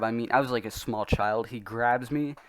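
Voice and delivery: usual speaking voice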